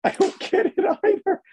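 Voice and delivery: high cartoonish squeak; squeaky silly voice.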